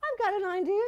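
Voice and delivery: high, gushing society lady; imitating Liz. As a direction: gush like a breathless theater lady. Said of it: "And so Liz said".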